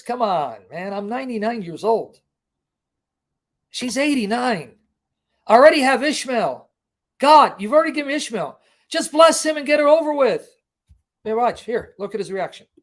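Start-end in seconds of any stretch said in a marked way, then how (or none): 0.0-10.5 Abraham protesting, rising higher and louder; hammed-up disbelieving old man pleading with God; incredulous Abraham. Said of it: "Abraham is incredulous".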